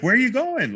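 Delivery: high-pitched playful WHERE ARE YOU GOING; pleading high question. Shown as a demonstration. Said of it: "the first part is a question".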